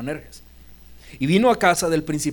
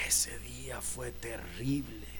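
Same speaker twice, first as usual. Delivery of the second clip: hushed whisper; 5 semitones lower, 15 dB quieter, slower than usual. Acted. Jairo recalling worst day.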